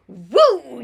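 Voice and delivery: loud, gleeful "Woo!"; whoop. Retold or imitated. Breathing in glue fumes in college, loud whoop. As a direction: let out a wild cheer. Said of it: "you just go".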